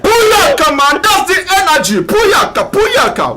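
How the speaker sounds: very high, excited cheer; soaring, high-energy radio hail; hyped catchphrase. Real, not acted. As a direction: whoop it back to the caller. Host echoes caller's hyped catchphrase.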